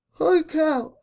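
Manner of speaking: spoken mid-yawn. Real, not acted. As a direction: yawn through the words. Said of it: "I'm so sorry I yawned".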